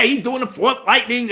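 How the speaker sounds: ordinary delivery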